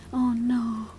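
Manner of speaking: ordinary talk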